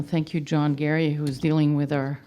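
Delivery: ordinary talk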